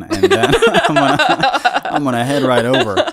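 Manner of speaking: laughing through the words. Real, not acted. Laughs mid-sentence.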